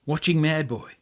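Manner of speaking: ordinary delivery, nothing marked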